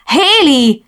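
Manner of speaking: high, loud cry of alarm; screamed name. A narrator's reading. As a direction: scream out to stop a friend. (rémülten sikoltva)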